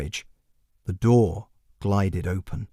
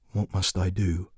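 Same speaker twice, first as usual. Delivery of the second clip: small, softer voice of a man undone; hushed, broken voice; 3 semitones lower, 5 dB quieter than usual. Acted. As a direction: barely get the words out. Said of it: "He almost didn't recognize his own voice".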